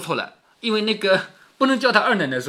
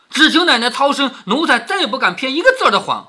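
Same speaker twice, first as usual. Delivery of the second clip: servant begging loudly, pitched up; frightened pleading; 5 semitones higher, 5 dB louder than usual. Acted. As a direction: plead for mercy with a touch of panic. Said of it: "他吓坏了". (惶恐地高声求饶)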